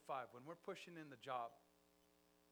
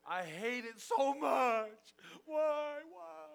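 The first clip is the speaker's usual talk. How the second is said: crying out in loud, slow, high anguish; anguished loathing; 11 semitones higher, 15 dB louder, much slower than usual. Shown as a demonstration. Preacher acting out hating his job, anguished cry. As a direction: wail it like you truly loathe the job. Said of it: "let me give you a visual definition".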